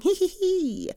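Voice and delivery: high cheeky hee hee hee giggle. Real, not acted. Host giggling at her own lockdown snacking, high cheeky giggle. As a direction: giggle guiltily at yourself. Joking about lockdown eating.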